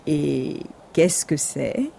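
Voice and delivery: slow, soft, low wolf question; curious wolf. Acted. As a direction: draw the question out, intrigued. Wolf asks, intrigued.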